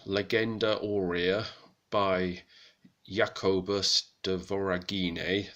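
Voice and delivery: slow, careful medieval Latin accent, each syllable weighed. Shown as a demonstration. Presenting a manuscript source, in a slow medieval Latin accent. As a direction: read the Latin title and author in a medieval Latin accent. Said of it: "That's my best medieval Latin accent".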